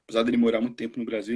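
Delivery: ordinary talk, nothing marked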